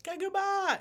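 very high-pitched mock scream; shrill imitation of screaming fans; imitated scream. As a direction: squeal it like excited screaming kids. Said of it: "like, scream and be like".

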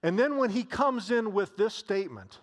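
normal talking voice

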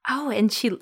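usual speaking voice

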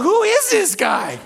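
high, slow, bewildered; baffled thought. Retold or imitated. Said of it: "And I just thought".

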